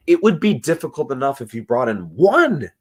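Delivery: ordinary talk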